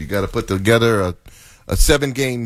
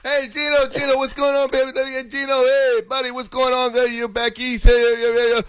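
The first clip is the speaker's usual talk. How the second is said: much higher, sing-song teasing of Fred; mock sweet talk; 14 semitones higher than usual. A cohost mocks Fred greeting a caller, high cooing voice. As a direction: ham up a smooth, cooing host greeting. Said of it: "You said it in a different voice".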